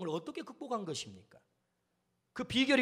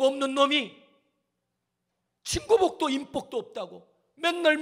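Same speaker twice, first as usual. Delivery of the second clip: loud, higher, crying out to God; pleading prayer; 3 semitones higher, 5 dB louder than usual. Acted. Jephthah crying out to God in prayer, pleading. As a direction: beg God as an outcast with nothing left.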